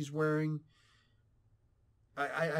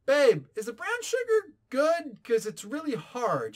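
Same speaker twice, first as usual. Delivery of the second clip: a touch higher and louder, slow and drawn out; mock husband question; 10 semitones higher, 7 dB louder than usual. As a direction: imitando sé stesso mentre chiede alla moglie, un po' più acuto e lento. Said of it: "It's like".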